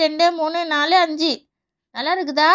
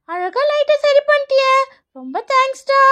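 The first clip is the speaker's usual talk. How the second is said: put-on high, happy childlike gratitude; delighted child; 4 semitones higher than usual. Child thanks friend for fixing the light, delighted.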